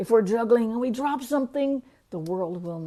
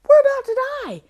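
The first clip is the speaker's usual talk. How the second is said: high, loud, frantic shout to wake a sleeper; panicked yell; 11 dB louder than usual. Retold or imitated. Disciples yelling at sleeping Jesus to wake up, panicked shout. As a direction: scream it like the boat is sinking. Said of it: "and they were like".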